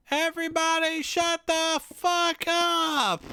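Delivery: very high, slow, loud mock yell; retold kid outburst. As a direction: imitate a little boy yelling to silence everyone. Little brother's outburst at bickering, acted.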